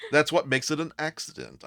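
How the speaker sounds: loud, high, acted self-defence; defensive protest. Defending himself in acted quarrel.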